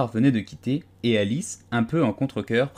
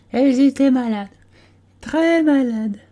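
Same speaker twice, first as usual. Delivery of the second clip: a dozy little animal insisting it was awake; sleepy Dormouse; 6 dB louder than usual. A narrator's reading. Dormouse defending himself to the tea party, drowsy and high.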